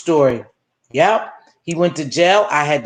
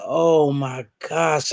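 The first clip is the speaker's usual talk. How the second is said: stunned dismay; 4 semitones lower, much slower than usual. Retold or imitated. Taking in the killing.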